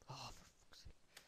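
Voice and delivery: muttered under the breath, unvoiced; exasperated whispered swear; whispered curse. Real, not acted. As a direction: hiss it quietly in frustration. Annoyed at game, whispered curse.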